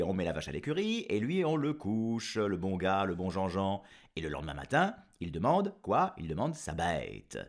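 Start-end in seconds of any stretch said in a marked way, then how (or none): none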